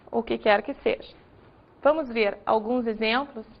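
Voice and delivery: ordinary delivery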